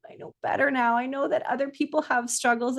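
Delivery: ordinary talk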